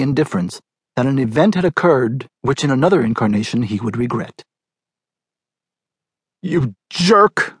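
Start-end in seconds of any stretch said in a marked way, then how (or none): 6.4-7.5 sharp, higher scolding jab; exasperated scold. Acted. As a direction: snap at him for wrecking the car. Crash, wife scolds husband.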